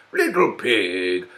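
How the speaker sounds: slow, sly wolf calling the pig. Acted. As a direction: lure the pig in, slowly. Wolf voiced in story.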